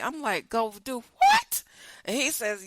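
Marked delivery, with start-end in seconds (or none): 0.5-1.6 incredulous. Retold or imitated. Shocked at midnight trip request.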